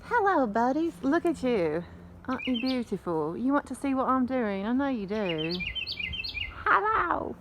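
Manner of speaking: high sing-song cooing at the animals. Real, not acted. Vlogger cooing hello to her animals in high baby talk. Greeting her pets mid-video.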